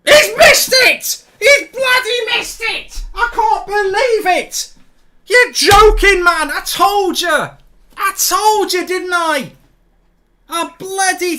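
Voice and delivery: very high, loud, slow yell of disbelief; real stunned outburst, loud and high; disbelieving shout. Real, not acted. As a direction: scream it as the penalty is missed. Penalty missed, fan explodes.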